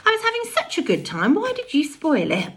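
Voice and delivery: high, fast playful dog voice; dog's voice. A narrator's reading. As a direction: speak as a disappointed dog. The dog's imagined voice.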